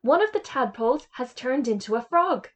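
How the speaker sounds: high, loud excited cry; a girl spotting the frog and calling to the class; excited shout. Acted. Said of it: "began to shout".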